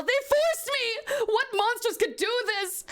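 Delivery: slow, high, stretched sob of forced; drawn-out wail. Acted. Anaconda at his brother's corpse, a slow high wail. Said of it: "Anaconda cries over his brother's body".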